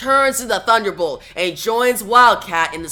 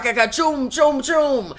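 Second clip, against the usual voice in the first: sound effects; 7 semitones higher than usual. Acted. Comic sound effects read out.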